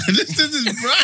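laughing. Real, not acted. Mocking echo with laugh.

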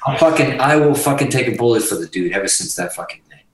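ordinary talk

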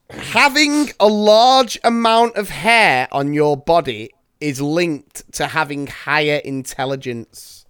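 ordinary delivery

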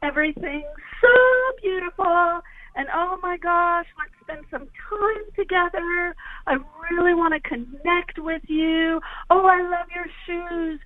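high, sing-songy and gushing throughout; gushing fire-earth talker. Shown as a demonstration. Host shows caller how fire earths talk, high sing-song gush. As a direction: gush sweetly like an overeager new friend. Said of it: "And fire earths talk like this".

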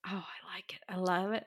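soft unvoiced whisper of glee; hushed, gleeful approval; delighted whisper. Real, not acted. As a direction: whisper it like a guilty pleasure.

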